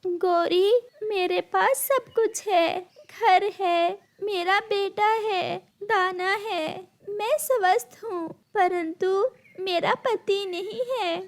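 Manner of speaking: high, tearful voice with sad pauses; acted crying, halting and high; weeping widowed bird. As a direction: sob it out piece by piece, high-pitched. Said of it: "तुम क्यों रो रही हो".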